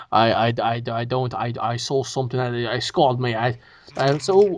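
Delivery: low, halting shell-shocked stammer; traumatized Thor voice. Retold or imitated. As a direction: stammer like a stunned giant. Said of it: "Why is he talking like Thor?".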